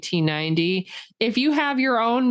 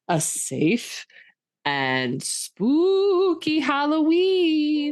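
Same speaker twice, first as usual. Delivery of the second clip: spooky witch voice; 9 semitones higher, much slower than usual. Acted. Halloween sign-off.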